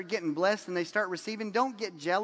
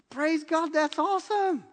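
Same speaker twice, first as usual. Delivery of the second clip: joyful praise; 7 semitones higher than usual. Real, not acted. Celebrating a friend's blessing.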